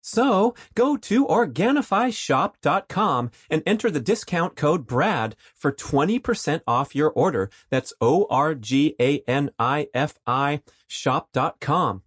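high, bright commercial pitch; upbeat ad read. Acted. Host reading a sponsor ad, upbeat announcer voice. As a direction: sell it hard like a radio spot.

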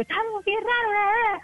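high, rushed imitation of puzzled folks back home; mock coastal reaction. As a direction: mimic everyone's reaction in a fast high voice. (imitación aguda y apresurada de la gente costeña)

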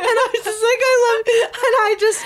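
very high, wailing laugh-cry; words squealed through tearful laughter; overcome with emotion. Real, not acted. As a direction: swept away, squeaking and half weeping. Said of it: "feel these huge waves of emotion".

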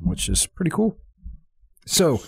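burp slipping into the words; burping after fizzy drink. Real, not acted. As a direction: talk through a beer burp. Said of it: "sorry, I'm, I'm burping".

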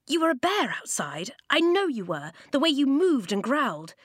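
put-on a boy sure of what he saw; insistent boy. Boy insists to old man.

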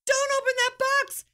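a panicked shout to stop him; yelling. Retold or imitated. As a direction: shout it out in alarm. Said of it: "And I yell".

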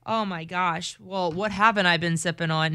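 usual speaking voice

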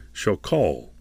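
normal talking voice

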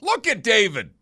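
high, loud excited holler; big high-pitched shout, falling at the end; yelled out. Retold or imitated. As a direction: re-enact yelling across a room. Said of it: "I just yelled out".

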